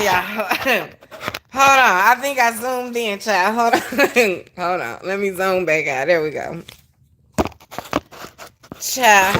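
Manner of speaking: ordinary talk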